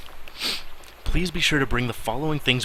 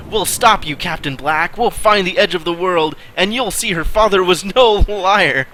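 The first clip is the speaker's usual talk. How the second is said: higher, louder defiant shout, breaking into a laugh; hammed-up yell; 7 semitones higher, 5 dB louder than usual. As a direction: cry it out defiantly. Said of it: "The first mate yelled out".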